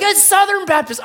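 high, loud acted answer; hanging man shouting. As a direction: holler it up from below.

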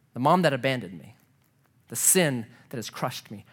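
ordinary talk

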